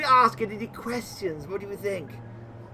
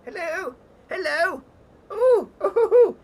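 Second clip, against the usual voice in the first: high, loud yelps from the heat; panicked squeals; 10 semitones higher, 8 dB louder than usual. Acted. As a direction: yelp as if burned.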